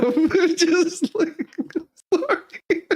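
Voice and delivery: high, slow, laughing so hard the words stretch; collapsing into giggles. Real, not acted. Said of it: "I know I shouldn't laugh".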